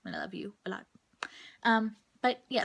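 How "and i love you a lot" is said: hushed, half-whispered thanks to a friend; soft aside to friend. Real, not acted. Whispering thanks to the friend who backed her up. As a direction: drop to a near whisper, sincere. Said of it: "if she's watching this".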